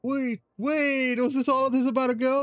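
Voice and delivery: surprised. Real, not acted. Startled reaction to game scene.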